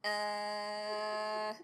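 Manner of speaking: normal talking voice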